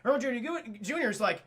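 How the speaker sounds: usual speaking voice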